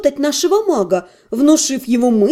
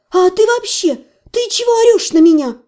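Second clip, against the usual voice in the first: indignant; 6 semitones higher than usual. A narrator's reading. Heroine scolds shouting mage.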